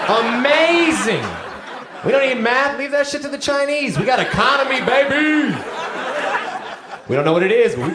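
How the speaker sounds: hammed-up brash pride; mock patriotic hype. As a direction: cheer like a proud flag-waver. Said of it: "mock patriotic hype".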